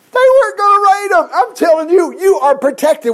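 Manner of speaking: high, loud, fervent insistence; fervent preaching. Real, not acted. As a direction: preach it hard, promise protection. Preaching reassurance to the flock.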